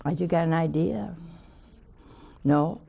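ordinary delivery

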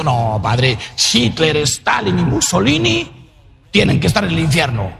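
a hint of a stubborn listener protesting; imagined parishioner. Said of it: "alguno pensando".

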